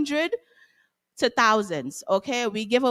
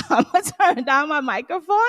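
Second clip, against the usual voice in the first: laughing, louder; 5 semitones higher, 3 dB louder than usual. Real, not acted. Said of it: "I think I'm extra loud too".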